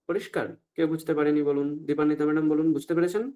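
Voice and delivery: ordinary talk, nothing marked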